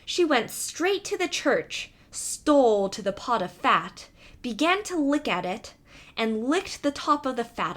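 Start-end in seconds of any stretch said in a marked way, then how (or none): none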